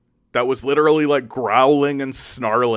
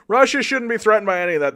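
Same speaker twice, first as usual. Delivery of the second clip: sarcastic; 11 semitones higher, 5 dB louder, faster than usual. Acted. Sarcastic repeat, much higher.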